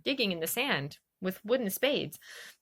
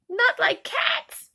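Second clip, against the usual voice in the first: much higher, slow, louder outraged squeak; a tiny creature offended to the core; indignant mouse squeaking at Alice, outraged; shrill, passionate mouse; 5 dB louder, much slower than usual. A narrator's reading. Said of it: "in a shrill, passionate voice".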